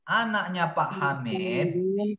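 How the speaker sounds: drawn-out, high singsong lament, dipping on the sigh to God; exasperated singsong. Real, not acted. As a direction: plead with hopeless pupils in long, lilting phrases. Teacher despairing over pupils' mistakes.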